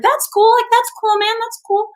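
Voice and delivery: high, repetitive mock approval; over-agreeable reaction. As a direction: humor him in a high-pitched voice. Humoring cop's odd nail explanation.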